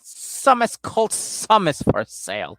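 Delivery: slow, loud sing-song explanation; mock Debbie. Mimicking Debbie explaining her piles, loud and slow. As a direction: spell it out slowly and loudly, starting high. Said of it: "That's what she says".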